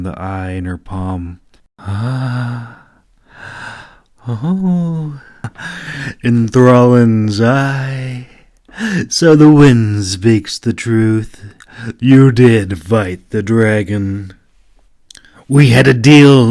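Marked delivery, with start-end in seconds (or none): 1.8-14.3 the oracle, breathy with wonder, then slow and loud; an ancient seer grasping a truth at last; oracle's voice. Acted. Oracle marvels at the dragon's eye to the clove, slow and loud. 15.5-16.5 loud, higher angry snarl. Acted. The clove demands payment from the oracle, loud snarl. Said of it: "the clove snarled".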